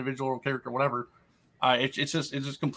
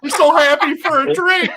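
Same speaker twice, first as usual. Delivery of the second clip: laughing mock passenger; 9 dB louder than usual. Mocking plane passenger joke.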